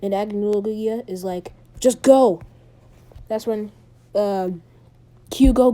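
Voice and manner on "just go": louder, mildly acted order; urgent command. Agnologia ordering Hugo to go and fight, urgent command. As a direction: send them off with a firm push.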